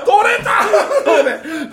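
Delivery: very high, loud, drawn-out exclamation; retold shock. Storyteller relives his tooth popping out, shocked outcry. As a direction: cry it out in comic dismay.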